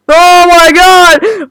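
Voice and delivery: slow startled gasp of shock. Real, not acted. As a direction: drag out the shock. Startled by cat.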